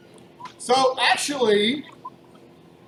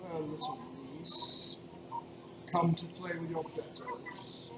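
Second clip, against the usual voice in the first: a hint of a deep, rumbling villain; lightly voiced rumbling giant taunting; 3 semitones lower, 14 dB quieter than usual. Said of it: "deep rumbling voice".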